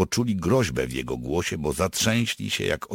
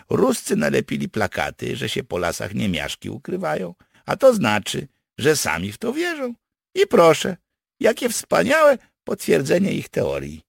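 high, animated character voice; pleased partisan schemer; 12 semitones higher, 6 dB louder than usual. Acted. Partisan explains his trick to his comrades, pleased.

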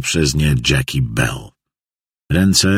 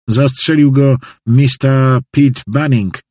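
higher, slow and emphatic announcement; announcing witness; 7 semitones higher, 4 dB louder than usual. A narrator's reading. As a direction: declare the killer's name slowly, pitched up. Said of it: "oznajmił Hop". (podniesione, dobitne oznajmienie)

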